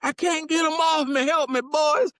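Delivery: high, loud, slow cry for help; old man's desperate plea. Retold or imitated. Old man stung by wasps begging the boys, desperate cry. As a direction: wail it like he's being stung. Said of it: "So he's fumbling".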